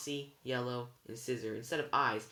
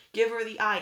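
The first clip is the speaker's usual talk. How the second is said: high, loud urgent order; scream; 9 semitones higher, 10 dB louder than usual. A narrator's reading. Frightened girl ordering her friend, screaming. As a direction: scream the command across the cab. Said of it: "Annabeth screamed".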